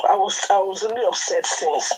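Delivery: ordinary talk, nothing marked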